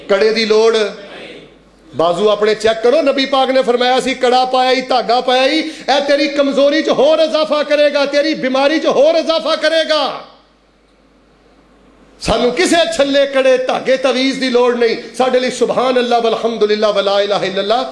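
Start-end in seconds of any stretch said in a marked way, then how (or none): 3.0-15.0 high-pitched warning; fervent sermon delivery, pitched high; impassioned preaching. Real, not acted. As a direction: warn the congregation in a raised pitch.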